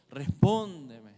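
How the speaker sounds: slow, loud, drawn-out plea; crying out. Pretend. David pleading with God, slow loud cry. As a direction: cry it out slowly like a desperate prayer.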